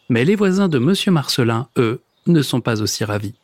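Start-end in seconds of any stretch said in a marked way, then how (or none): none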